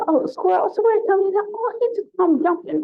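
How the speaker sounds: high sweet imitation of her delight; imitating Sada. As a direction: pipe it up high like Sada, unbothered. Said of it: "She said".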